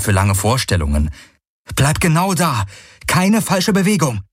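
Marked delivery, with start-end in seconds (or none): 1.8-4.2 tense warning. A narrator's reading. Threatening with laser.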